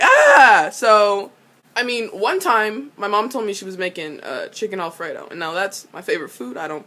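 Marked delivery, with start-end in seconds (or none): 0.0-0.7 a loud "Ah!" gasp; loud gasp of awe. Retold or imitated. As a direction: gasp out as if stunned. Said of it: "I would have been like".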